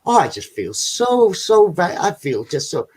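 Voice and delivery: dreamy sedated woman. Retold or imitated. Mimicking the blissed-out lady in the next bed, slow and high. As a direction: drift through it like she's floating on sedatives. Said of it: "she's like".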